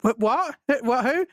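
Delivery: startled confusion. Retold or imitated. Recognized by someone in the queue, startled and confused. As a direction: act out being caught off guard, rising at each question.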